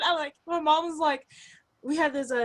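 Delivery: ordinary talk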